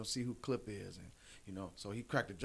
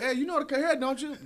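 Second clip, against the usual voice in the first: high, loud hyped-up ask; eager mock question; 13 semitones higher, 10 dB louder, faster than usual. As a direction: pitch it like a too-good offer. Acted quote of salon offer.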